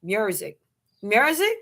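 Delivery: "music" said as "myur-zik", then "mer-zik"; testing a pronunciation. Shown as a demonstration. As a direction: try out an r-coloured "music", as if unsure. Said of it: "How you say music?".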